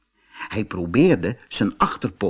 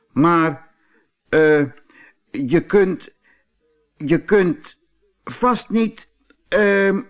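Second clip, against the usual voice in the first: high bull stammering; hesitant bull; 8 semitones higher, 4 dB louder, slower than usual. Acted. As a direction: falter for words as the stumped bull. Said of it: "begon de stier weer".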